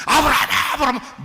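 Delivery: high, loud shout of a name; frantic urgent calling. Acted. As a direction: call out urgently, as if stopping someone's hand. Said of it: "누가 떨어?".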